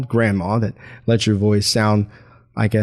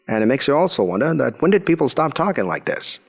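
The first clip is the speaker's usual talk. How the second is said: ordinary delivery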